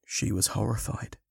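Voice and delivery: usual speaking voice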